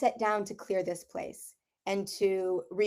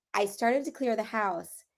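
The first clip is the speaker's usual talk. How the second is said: ordinary talk, nothing marked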